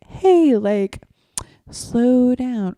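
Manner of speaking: gentle, friendly caution with a high "Hey"; nice, calm heads-up; very nicely. Shown as a demonstration. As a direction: nudge the driver kindly, no panic. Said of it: "very nicely say".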